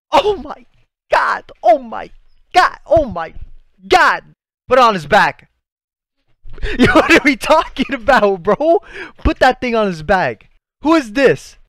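high, loud burst of hype; excited shouting. Real, not acted. Fan seeing the hero back in action, excited shouting. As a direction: shout it out, thrilled and overwhelmed. Reacting to a hero's comeback.

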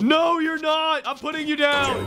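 high, stretched-out battle yell; defiant shout. Real, not acted. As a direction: shout back at the attacker. Fighting off the attacker.

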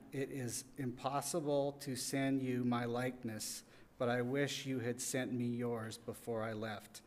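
usual speaking voice

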